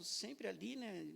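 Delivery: normal talking voice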